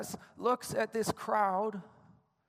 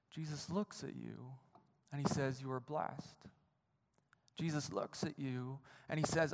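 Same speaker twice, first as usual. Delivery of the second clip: much softer, lower, close to the listener; hushed and intimate; 4 semitones lower, 7 dB quieter than usual. Acted. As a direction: draw them in with a hushed voice. Intimate retelling of Jesus.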